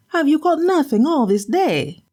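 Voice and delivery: high, nagging wife's voice; scolding wife. Acted. Wife scolding her husband for an empty catch.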